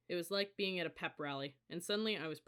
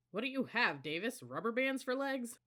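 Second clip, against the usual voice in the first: mocking taunt; 7 semitones higher, 4 dB louder than usual. A narrator's reading. Squad leader taunting new cadet.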